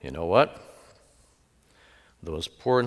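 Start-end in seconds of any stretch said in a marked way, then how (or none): none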